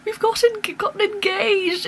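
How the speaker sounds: high, breathless announcement; excited news. Retold or imitated. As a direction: burst out with the news. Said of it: "ran up the stairs and was like".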